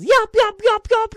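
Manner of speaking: high, loud echoing yips; imitated coyote yelps. Imitating the coyote echoing down the canyon, high loud yips. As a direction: yelp like a coyote chasing close behind. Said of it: "sounded like that coyote was right on my heels".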